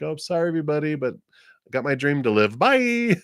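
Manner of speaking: high, sing-song cheery goodbye; playful high-pitched kiss-off; breezy mock farewell. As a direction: toss off an apology, then a soaring "Bye!". Acting out quitting for dream job.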